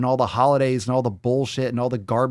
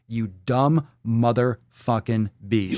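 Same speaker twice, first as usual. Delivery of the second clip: slow, word-by-word contempt; deliberate insult; slower than usual. Real, not acted. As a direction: space out every word.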